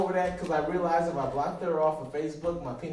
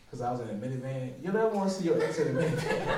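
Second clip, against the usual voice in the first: normal talking voice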